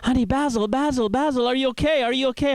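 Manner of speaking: high, frantic calling of his name; wife finding him fainted on the floor, high and frantic; panicked wife. Retold or imitated. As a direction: call him like a scared wife over his body. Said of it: "She's like".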